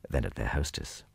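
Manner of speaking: usual speaking voice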